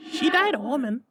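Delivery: very high, otherworldly spirit; ghost voice. A narrator's reading. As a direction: float it like an eerie spirit. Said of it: "said the ghost".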